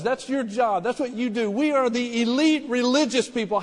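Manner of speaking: a sneering religious elite, hammed-up; mock Sanhedrin rebuke. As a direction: scornfully voice the elite rebuking Stephen. Preacher voices angry Sanhedrin.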